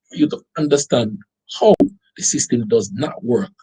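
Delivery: ordinary delivery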